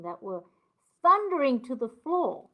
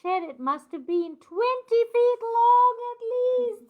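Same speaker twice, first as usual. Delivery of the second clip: dramatic build; 11 semitones higher, 6 dB louder than usual. Acted. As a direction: build awe up to a cry. Dramatic reading of huge creature.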